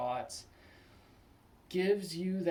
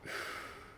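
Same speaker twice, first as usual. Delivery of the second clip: long breath out to pause; demonstrative composing exhale; slow sigh before answering; deliberate sigh; 7 dB quieter than usual. Acted. Taking a moment before answering.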